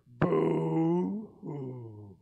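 hammed-up fake crying; mock boo-hoo. The Grinch mocks the crying Whos with a fake sob. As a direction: sneer a pretend sob.

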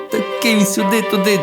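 plain reading voice

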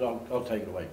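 ordinary delivery

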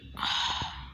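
heavy whispery exhale; long sigh. Real, not acted. Gamer unable to join, long sigh. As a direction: let out a long, resigned breath. Resigned after failing to join.

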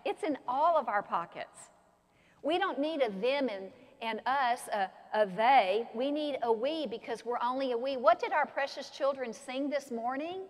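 normal talking voice

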